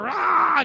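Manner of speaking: high, mock monster roar; werewolf roar. Werewolf seeing the wife, imitated roar. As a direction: roar as the beast lunging, hammed-up. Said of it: "Then the werewolf sees her".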